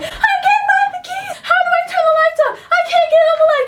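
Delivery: very high, fast frantic squeal with a laugh; imitating the driver panicking, high and fast; panicked driver. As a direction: fumble for the keys in mock panic. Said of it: "all of us are freaking out".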